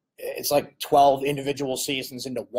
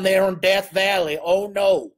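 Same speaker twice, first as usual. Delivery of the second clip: a light, hammed-up Southern accent, a bit higher and louder; bad Southern accent; 5 semitones higher, 4 dB louder, slower than usual. Host joking about Death Valley, in a mock Southern accent. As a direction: drawl it like a mock Southern fan, half joking. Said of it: "pardon my bad Southern accent".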